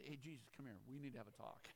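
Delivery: low, hushed confidential aside; taking him aside. Acted. Peter takes Jesus aside for a talk, low and confidential. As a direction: pull him quietly aside for a word. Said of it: "Peter took him aside".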